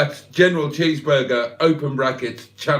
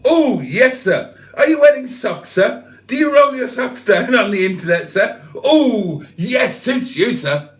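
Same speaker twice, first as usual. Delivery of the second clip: catchphrase tailor; 6 semitones higher, 4 dB louder than usual. Retold or imitated. Catchphrase bit aimed at a viewer.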